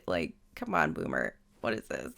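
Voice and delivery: low teasing complaint; mock deep grumble. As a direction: grumble at him like a fake-stern buddy. Teasing villager's arctic house.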